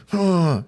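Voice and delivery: high, loud imitated huff; frustrated huff. Son struggling with the Legos, imitated frustrated huff. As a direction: huff like an exasperated kid. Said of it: "he's making all these frustrating noises".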